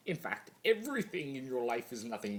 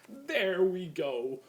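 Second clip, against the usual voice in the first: high, loud, drawn-out triumphant cry; gleeful; 12 semitones higher, 7 dB louder, much slower than usual. Acted. Chaos spirit admiring his new golden tree, gleeful. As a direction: savour the finished prank out loud.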